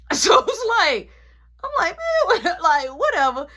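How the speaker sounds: high, loud laughing through the words. Real, not acted. Laughing after nana gives him the finger, high and loud. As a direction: laugh in disbelief while talking.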